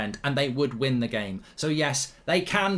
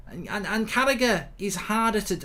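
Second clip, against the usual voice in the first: usual speaking voice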